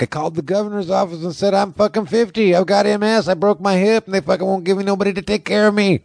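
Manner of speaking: high, strained rant imitating an aggrieved brother; brother impression. Imitating injured brother raging about care, high brother impression. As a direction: imitate your brother's high-pitched complaining. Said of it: "that's my best imitation of my brother".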